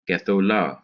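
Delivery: Gethola with the accent on the final la; Gethola, short first syllable, stressed ending; stress on last syllable. Shown as a demonstration. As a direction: hurry through "Ge" in Gethola and land on "la". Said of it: "is going to be on the last syllable".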